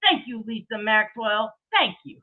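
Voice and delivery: ordinary delivery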